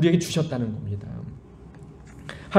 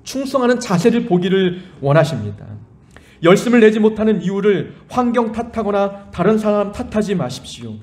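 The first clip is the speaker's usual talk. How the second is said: louder, higher exhortation; preaching at full force; 4 semitones higher, 4 dB louder than usual. Acted. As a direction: urge the congregation with fervor.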